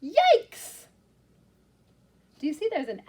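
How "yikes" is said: high, loud squeal of yikes; yelled yikes. Shown as a demonstration. Teacher leading the class in a loud yikes. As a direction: shriek it with the kids. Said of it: "Can you yell yikes with me?".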